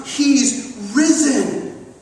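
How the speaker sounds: HE'S RISEN very slow, each word weighted; emphatic. Retold or imitated. Disciples telling Thomas the news, emphatic and slow on "He's risen". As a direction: draw out "He's risen" slowly and firmly. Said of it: "they were emphatic, telling Thomas".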